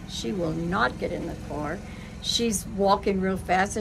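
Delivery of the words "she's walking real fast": normal talking voice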